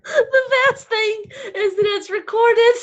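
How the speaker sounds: trying to talk but laughing too hard; uncontrollable laughter. Real, not acted. Talking to her live viewers, collapsing into laughter. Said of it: "I can't pull it together".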